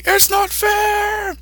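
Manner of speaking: very high, slow, drawn-out tantrum wail; mega scream. Acted. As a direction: stretch it into a childish howl. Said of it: "this mega scream".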